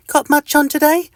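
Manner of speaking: high, cheery tiny-creature greeting; friendly little itch. Acted. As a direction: greet your neighbour brightly and squeakily. Mister Itch chatting.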